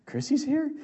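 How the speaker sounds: high, disbelieving question; husband stunned, high surprised echo. Retold or imitated. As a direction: ask back in stunned surprise. Stunned husband asks back.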